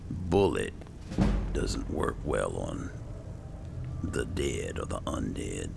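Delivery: ordinary talk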